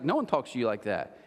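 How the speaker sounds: normal talking voice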